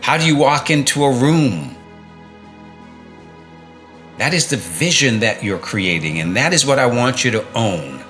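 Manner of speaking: ordinary delivery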